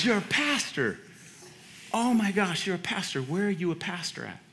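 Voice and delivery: imitating the coach's disbelief; amazed coach. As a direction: coach marvels that his old player is a pastor.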